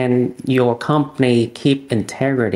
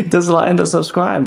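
a higher, playful YouTuber sign-off; mock plug; 6 semitones higher than usual. Joking to the host, mock YouTuber plug. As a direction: sell it like a cheeky channel ad.

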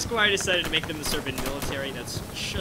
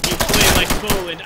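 shouted insult; 9 dB louder than usual. Pretend.

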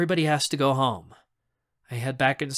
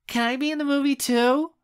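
put-on high, pleading child voice; eager little girl; 13 semitones higher, 3 dB louder than usual. Mitzi asks to join the movie, eager little girl. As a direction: lilt up high like a small kid asking.

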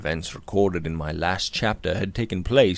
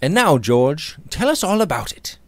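higher-pitched, nonchalant invitation; light unconcern, voice lifted; old indifference; 6 semitones higher than usual. A narrator's reading. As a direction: casually ask a friend for his story. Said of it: "saying with his old indifference".

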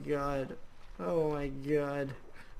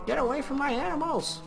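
much higher angry yell; 11 semitones higher than usual. Real, not acted. Gamer yelling at mobs attacking his animals, high and angry. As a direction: shout them off the animals.

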